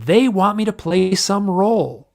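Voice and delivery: retold scream. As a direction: cry it out in exasperation, reenacting. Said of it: "And I scream".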